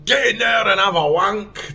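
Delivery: slow, high, loud Scottish order; Scottish accent. Retold or imitated. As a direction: imitate a Scottish corporal shouting orders. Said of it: "This is my best Scottish accent".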